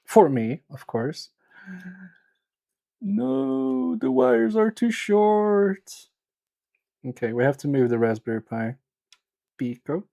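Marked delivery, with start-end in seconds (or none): 3.0-6.1 high, drawn-out whine; whiny dismay. Real, not acted. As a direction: wail it slowly in a high, thin complaint. Whining that wires are short.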